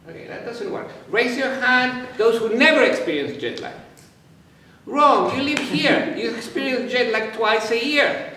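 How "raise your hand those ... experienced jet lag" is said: raised voice to the hall; louder, higher call. Real, not acted. Repeats request to the audience.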